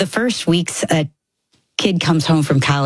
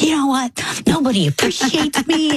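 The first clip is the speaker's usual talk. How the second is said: mock mad outburst; 8 semitones higher than usual. Mom retelling her blow-up at her kids, mock indignant. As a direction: play up being fed up. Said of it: "I got so mad".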